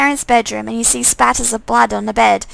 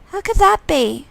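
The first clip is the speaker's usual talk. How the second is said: higher questioning; bewildered; 7 semitones higher than usual. Pretend. Player asking the twin how, higher. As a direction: ask in disbelief.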